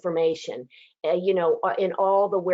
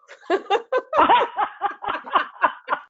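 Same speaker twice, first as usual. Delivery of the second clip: long, high-pitched laugh; hearty laugh; 12 semitones higher, 4 dB louder than usual. Real, not acted. Cracking up at a colleague's feedback joke, hearty laughter.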